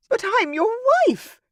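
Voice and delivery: high, loud sobbing cry; sobbing duchess. A narrator's reading. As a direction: plead through tears. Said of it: "sobbed the Duchess of Arrow".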